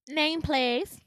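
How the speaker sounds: high, loud mock-officious request; impatient receptionist. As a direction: imitate a bored clerk demanding details. Receptionist curtly demanding the name.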